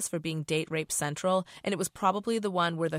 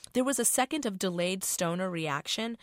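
ordinary delivery

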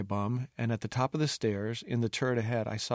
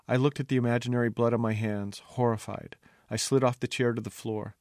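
ordinary delivery

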